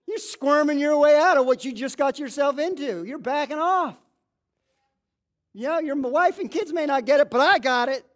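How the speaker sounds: Holy Spirit scolding a believer who is dodging, loud and high; Holy Spirit rebuking. Retold or imitated. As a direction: confront the squirming sinner, rising to a shout. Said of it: "the Holy Spirit's going".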